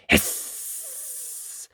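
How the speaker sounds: very high breathy hiss; snake hiss. Acted. Snakes appear.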